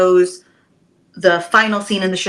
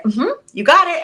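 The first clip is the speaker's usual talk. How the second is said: eager yes; 9 semitones higher, 6 dB louder than usual. Retold or imitated. Eagerly agreeing to more work.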